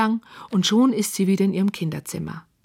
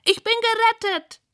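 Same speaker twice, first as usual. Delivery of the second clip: high, relieved pig squeal; high piggy voice, saved at last; faster than usual. A narrator's reading. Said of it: "quiekt Rosamunda".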